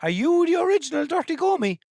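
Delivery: high, loud excited call; excited hail. Real, not acted. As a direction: shout the question up high. Host excitedly hailing the AI assistant.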